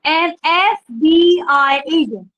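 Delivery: "एफ़बीआई" with an ee-sound start, slowly; an FBI. Shown as a demonstration. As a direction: say "एफ़बीआई" as "ee-ef-bee-eye", stretched out. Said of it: "यहाँ पे जो एफ है".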